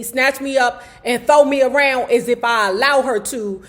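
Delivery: normal talking voice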